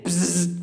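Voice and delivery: imitated insect buzz; buzzing noise. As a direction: buzz like a bug in the wall. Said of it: "Then I heard it again".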